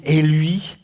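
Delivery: put-on mildly dismissive teenage tone; disrespectful. Teen annoyed at father, talking to mother, disrespectful. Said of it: "de manque de respect".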